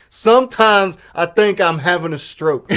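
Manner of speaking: exaggerated loud quote. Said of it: "I'm over here like".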